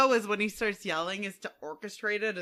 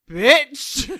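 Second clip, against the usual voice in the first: sudden loud, high bark of a word; mock dog yell; 4 semitones higher, 12 dB louder than usual. Owner voicing Briggs yelling at her for nothing, loud mock bark. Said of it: "Just yells at me for nothing".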